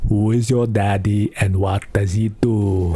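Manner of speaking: lightly voiced slow, deliberate action-hero recital; Terminator love poem. Terminator reading love poems as a skit, lightly voiced. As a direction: recite love verse slowly, a hint of the Terminator.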